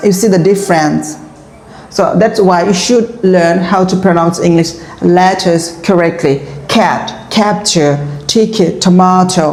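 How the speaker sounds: slow, careful English. Shown as a demonstration. Teacher slowly modelling English for students. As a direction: spell it out slowly. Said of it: "English outro".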